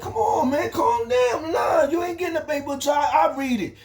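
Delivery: shouted, cocky taunt. Retold or imitated. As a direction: tease him while holding the paper out of reach. Acted taunting during paper fight.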